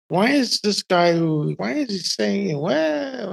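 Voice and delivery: slow, softening, rising confusion; acted bewilderment. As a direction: puzzle it out slowly, baffled. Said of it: "I've got brain fog".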